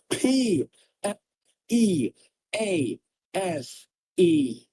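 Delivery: P, E, A S E each spat out loud, slowly; spelled out letter by letter. Real, not acted. Streamer spelling please at his chat, each letter hit. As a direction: hammer out every letter of P E A S E slowly.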